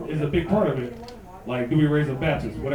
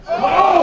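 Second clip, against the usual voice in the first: high loud pained oh; stung oh; 11 semitones higher, 7 dB louder than usual. Real, not acted. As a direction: cry out as if hit. Reacting to the burn.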